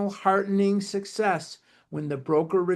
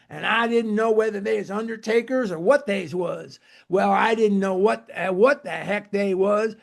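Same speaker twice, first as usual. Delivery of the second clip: put-on Kentucky accent; 3 semitones higher than usual. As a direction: imitate Bill Dotson's thick Kentucky accent, lightly. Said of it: "he had a very thick Kentucky accent".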